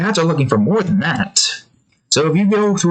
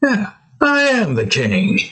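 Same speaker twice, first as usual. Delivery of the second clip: proud Duke; 9 semitones higher than usual. Retold or imitated. Duke voiced answering cats.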